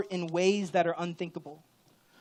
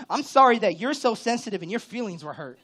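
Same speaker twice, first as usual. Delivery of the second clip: loud, higher, insincere sorry; backhanded apology; 5 semitones higher, 10 dB louder than usual. Shown as a demonstration. Pastor demonstrating a backhanded apology to the congregation, loud and mocking. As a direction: sneer the apology, louder and higher. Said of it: "That's called a backhanded apology".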